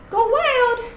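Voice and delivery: high-pitched playful cue to a dog; rally the dog to play; bright-eyed, high call to start the game; excited cue. Shown as a demonstration. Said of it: "I'm gonna say".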